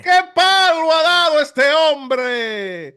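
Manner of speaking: drawn-out roaring home-run call; Spanish radio call. Retold or imitated. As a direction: stretch it like a radio play-by-play.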